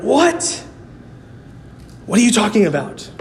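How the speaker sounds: imitating the stunned crowd's confusion; mock shocked disbelief. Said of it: "And they're like".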